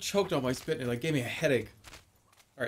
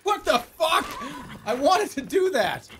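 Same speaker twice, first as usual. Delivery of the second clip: laughing; 7 semitones higher, slower than usual. Real, not acted. Laughing at a game fail.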